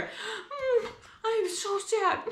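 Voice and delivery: high, soft whimpering mock sadness; mock sad. Shown as a demonstration. Mom acting sad in emotions charades, soft mock whimper. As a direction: play sad for the kids, over the top. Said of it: "see if I act it out right".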